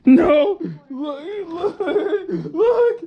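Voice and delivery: high squealing laughter; laughing so hard it squeaks. Real, not acted. Dad laughing at son's reaction.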